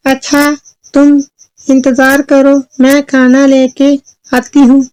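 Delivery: high mother voice, phrase by phrase; storybook mother. Acted. Mother tells daughter to wait for food, high voice. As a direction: speak high with small pauses. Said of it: "चुलबुल की माँ ने कहा".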